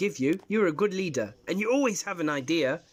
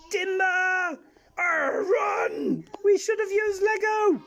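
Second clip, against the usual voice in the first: panicked yelling; 12 semitones higher, slower than usual. Acted. Pirate fleeing his collapsing restaurant, panicked shout. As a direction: cry out as it all falls down.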